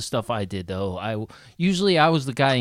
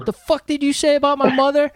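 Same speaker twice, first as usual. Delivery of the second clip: put-on drunk aggression; 12 semitones higher, 3 dB louder than usual. Drunk picking a fight.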